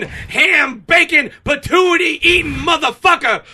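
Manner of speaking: high, slow, booming wrestler promo; wrestling promo. Acted. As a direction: work the crowd like a heel on the mic. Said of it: "I'm doing my promo".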